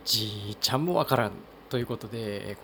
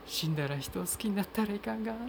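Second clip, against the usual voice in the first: remembered film line; 11 semitones higher than usual. Retold or imitated. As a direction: recall the line gently from memory, pitched up high. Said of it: "私の記憶の中にあるのは".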